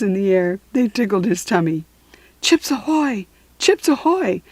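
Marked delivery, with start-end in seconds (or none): 2.4-4.4 high yelled code call; yelling. Acted. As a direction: keep yelling it into the radio. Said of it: "Stink was still yelling".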